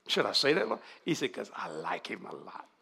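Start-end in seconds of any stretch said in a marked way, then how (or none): 1.3-2.7 low, soft quoted reply; quiet confiding. Acted. Preacher voicing the Lord's answer about the man, low and soft. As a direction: confide it gently in a dropped voice. Voices the Lord confiding his reason.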